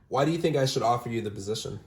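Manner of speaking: ordinary talk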